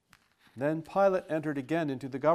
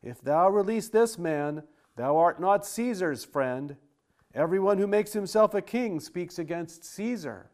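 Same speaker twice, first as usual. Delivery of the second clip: loud, higher threat from the mob; the crowd shouting down Pilate's mercy; crowd crying out; 4 semitones higher, 5 dB louder than usual. Acted. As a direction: warn Pilate with a raised voice.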